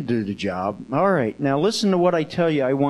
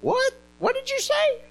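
high-pitched, confused questioning; acted startled waking. Eli roused from sleep by Samuel, play-acted bewilderment. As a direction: jolt awake and squeak the question.

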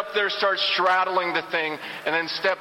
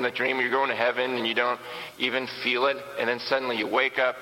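ordinary talk, nothing marked

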